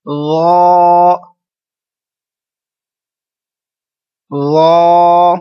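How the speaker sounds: soft drawn ذا; soft ذا without whistle. Shown as a demonstration. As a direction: model ذا gently, no whistle. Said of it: "ذا پر سیٹی کی آواز نہیں آئے".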